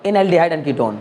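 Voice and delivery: normal talking voice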